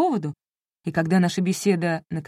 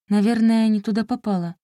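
ordinary delivery, nothing marked

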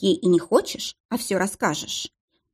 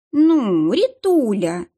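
drawn-out offended whine; hurt drawl; 3 semitones higher, 4 dB louder, much slower than usual. A narrator's reading. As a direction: stretch it out, sulking. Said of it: "обиженно протянула Дарья". (обиженно, протяжно)

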